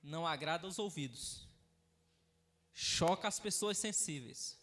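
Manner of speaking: low, soft, subdued pulpit voice; hushed, measured closing words; solemn sermon closing. Acted. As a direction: bring the congregation down quietly toward prayer. Solemn closing before prayer.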